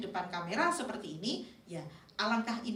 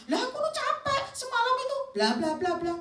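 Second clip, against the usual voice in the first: high-pitched, loud ranting protest; mock student flying off the handle; shouting; 5 dB louder than usual. Student excusing missed homework, yelling back indignantly. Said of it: "nah, dia teriak".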